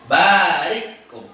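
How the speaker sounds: "Barikum" slow, long "baa" and stopped "ri"; hamzah sukun. Shown as a demonstration. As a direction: halt sharply after "ri" in "Barikum". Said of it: "Kalau sukun hamzah berarti". ("Barikum" pelan, hamzah mati setelah ri)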